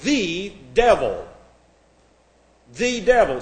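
"the" set apart and stressed before "devil". Shown as a demonstration. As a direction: lean on "the" as a separate, weighty word. You have an article.

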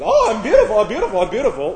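high, loud gushing send-up; mock self-admirer. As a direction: gush in a hammed-up high voice. Mimics a deluded self-admirer.

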